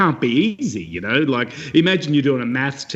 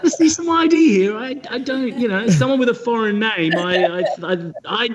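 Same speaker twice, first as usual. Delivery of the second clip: hammed-up disbelieving official; suspicious official; 9 semitones higher than usual. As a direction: play a sceptical clerk eyeing the ID. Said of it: "and you'll be like".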